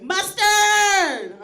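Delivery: high, loud yelled MUSTARD. Acted. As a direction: shout the word out wildly. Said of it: "I'd yell a condiment".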